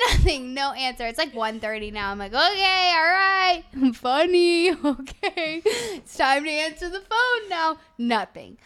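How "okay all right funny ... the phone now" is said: high, loud, sing-out exasperation; loudly fed up, stretching it out; mock patience running out. Fed up with nobody answering the phone, exasperated. Retelling impatience at unanswered calls.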